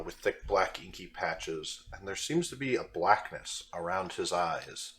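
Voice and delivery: usual speaking voice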